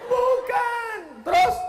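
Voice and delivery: very high, loud falsetto cry; mock shrill woman. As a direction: squeal like a startled mother. Mother voice, shrill shout. (jeritan ibu tinggi yang dibuat-buat)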